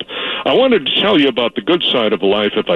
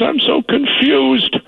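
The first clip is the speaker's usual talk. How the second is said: put-on high, slow, lost old woman; confused old lady; 13 semitones higher, slower than usual. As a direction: quaver like a bewildered elderly lady. Caller voices the confused old lady.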